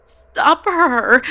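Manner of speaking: high, loud cry for help; desperate shout. A narrator's reading. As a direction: scream it out in panic. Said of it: "Liliana cried".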